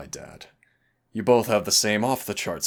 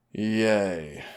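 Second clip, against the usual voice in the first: playful cheer; 3 semitones higher, 5 dB louder than usual. Acted. Cheering about teaming up.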